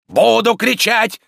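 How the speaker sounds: high, loud, drawn-out bellow; defiant yelling. Acted. Tarantyev refuses to be hushed, defiant yelling. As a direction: bawl it out defiantly. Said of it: "вопил Тарантьев".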